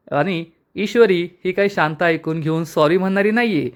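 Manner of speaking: plain reading voice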